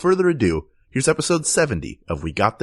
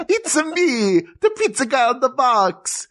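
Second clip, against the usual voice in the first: high, hammy Italian mascot voice; 11 semitones higher, slower than usual. Acted. Pizza box guy announces himself, mock Italian accent. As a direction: pipe up in cartoon Italian accent. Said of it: "don't think he's saying".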